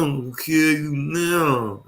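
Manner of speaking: slow, drawn-out playful threat; mock threat. Husband joking about married life, mock threat. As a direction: say it slowly in mock exasperation.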